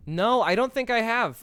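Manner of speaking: high, loud sudden realisation; surprised. Real, not acted. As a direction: catch yourself mid-thought. Sudden self-correction.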